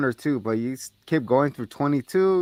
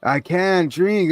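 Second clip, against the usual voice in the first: slow mock drunk; 5 semitones higher, 4 dB louder, much slower than usual. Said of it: "slow drunk quote".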